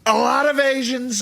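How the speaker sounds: high, slow, shouted Trump; Trump rally voice. Shown as a demonstration. Showing Trump's rally delivery, shouted high. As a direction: bellow it slowly like a rally speech.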